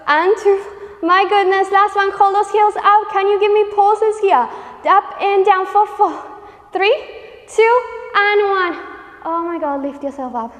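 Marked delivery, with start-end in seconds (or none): 0.1-8.7 loud, high-pitched push through the burn. Real, not acted. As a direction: cheer them through the last reps. End of a hard set.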